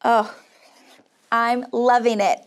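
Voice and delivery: ordinary delivery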